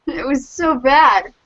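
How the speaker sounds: normal talking voice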